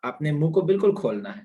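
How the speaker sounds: usual speaking voice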